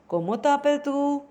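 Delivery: lifted asking voice on "Como tapel tu?". Shown as a demonstration. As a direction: raise the pitch for "Como tapel tu?". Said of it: "a little bit higher when you are asking the question".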